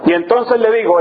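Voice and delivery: ordinary talk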